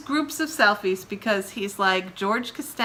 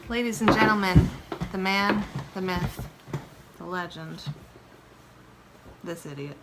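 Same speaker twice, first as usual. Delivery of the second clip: mock announcer; 3 semitones lower than usual. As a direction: start big then deflate it. Mock announcer introduction, fading.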